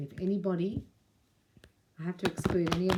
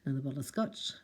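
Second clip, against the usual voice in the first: much softer, deflated mock sigh of a line; mock low mood; 4 semitones lower, 7 dB quieter, faster than usual. Listener feeling low about themselves mutters softly, a mock deflated line. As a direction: imitate someone who feels so low they reach for scotch. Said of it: "As you feel so low about yourself, you go".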